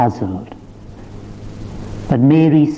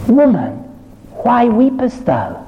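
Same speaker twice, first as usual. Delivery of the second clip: preached angel's question; 11 semitones higher than usual. Acted. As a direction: ask it as a bright, ringing wonder. Angels question Mary, preached joyfully.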